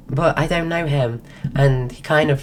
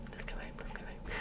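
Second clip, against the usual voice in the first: whisper; 24 dB quieter, faster than usual. Retold or imitated. Lost voice, retelling her reply.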